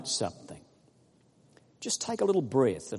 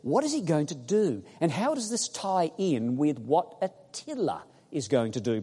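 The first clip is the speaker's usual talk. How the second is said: normal talking voice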